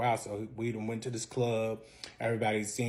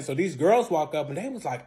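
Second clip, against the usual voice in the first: ordinary talk, nothing marked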